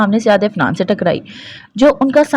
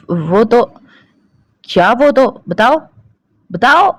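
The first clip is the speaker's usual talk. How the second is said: stern demand; 5 semitones higher, 3 dB louder, slower than usual. A narrator's reading. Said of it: "Umar ने सख्त लहजे में कहा".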